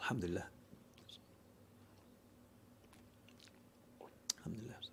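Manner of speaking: breathy whispered Alhamdulillah, barely voiced; a soft whispered prayer of gratitude; whispered thanks. Real, not acted. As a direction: murmur thanks to God under the breath after a sip. Whispered thanks after drinking.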